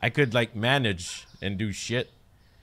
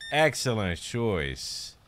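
normal talking voice

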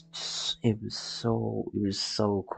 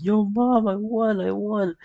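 much higher and louder, bursting with joy; excited kid; 12 semitones higher, 5 dB louder than usual. Retold or imitated. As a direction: shout the news to mom. Telling mom he won.

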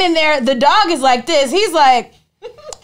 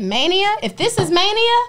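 giddy mania; 9 semitones higher than usual. Retold or imitated. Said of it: "I said".

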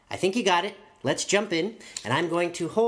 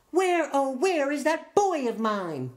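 calling mom; 9 semitones higher than usual. Acted. Mom calling for her son.

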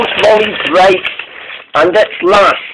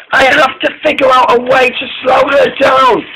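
high-pitched, pressing urgency; urgent resolve; 9 semitones higher than usual. A narrator's reading. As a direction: pitch it up as if racing along. Molly pushed by runaway engine.

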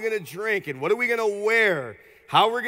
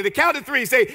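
ordinary delivery

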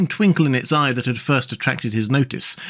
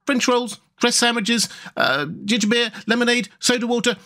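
high-pitched rattled-off list; matter-of-fact high Rat voice, speeding up; brief, brisk listing; 11 semitones higher than usual. A narrator's reading. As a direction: reel off each item briskly. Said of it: "replied the rat briefly".

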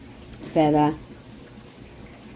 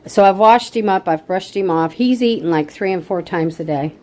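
normal talking voice

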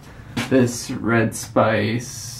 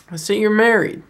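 lightly imitated Scottish accent, pitched high; hint at an older Scotsman, voice raised in pitch; 9 semitones higher, 3 dB louder than usual. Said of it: "he's got this thick Scottish accent".

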